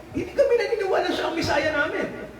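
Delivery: quick, high imitation of a flat refusal; high fast mock denial.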